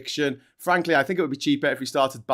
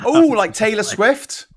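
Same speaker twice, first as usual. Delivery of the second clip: surprised; 7 semitones higher, 7 dB louder, slower than usual. Real, not acted. Teasing about age.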